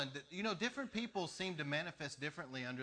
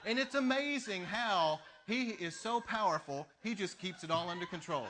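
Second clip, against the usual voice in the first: laughing delight; 4 semitones higher, 5 dB louder than usual. Real, not acted. Preacher laughing over God keeping control, laughing and louder. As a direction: marvel at it through laughter.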